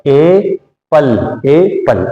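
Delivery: "ए पल" said very slowly, split into ए and पल; syllable-by-syllable blending. Shown as a demonstration. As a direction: sound out "ए ... पल" with a gap between the two parts. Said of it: "तो बेटा ये क्या हो गया". ("ए पल" को धीरे-धीरे, ए और पल अलग करके बोलना)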